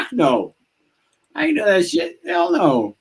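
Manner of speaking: high, emphatic mock shout; first sergeant's refusal. First sergeant refusing promotion, retold.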